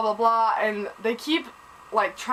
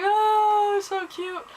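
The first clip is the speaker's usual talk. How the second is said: gushing aww; 11 semitones higher, much slower than usual. Retold or imitated. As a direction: coo it like a fan melting over a couple. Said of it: "And I was like".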